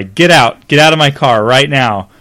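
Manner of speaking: tearful yell. A narrator's reading. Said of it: "She was starting to cry".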